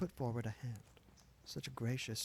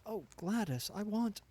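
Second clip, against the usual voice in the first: plain reading voice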